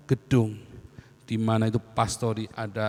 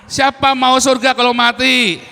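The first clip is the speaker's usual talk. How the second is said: high, loud, slow call-out; loud challenge; 11 semitones higher, 12 dB louder, slower than usual. Real, not acted. Preacher asks again who wants heaven, loud slow challenge. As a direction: draw the question out to the back row.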